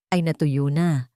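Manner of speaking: usual speaking voice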